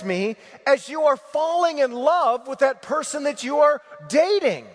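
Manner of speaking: swelling repeated appeal, voicing Jesus with rising fervor; preaching at full force. Preacher pleading as Jesus with his congregation, building to a shout.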